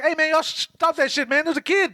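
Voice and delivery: high, urgent chief yelling stop; mock police chief. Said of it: "he's like".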